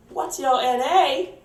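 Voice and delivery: high, slow put-on boss voice; mock boss explaining, high and slow; imitating the boss.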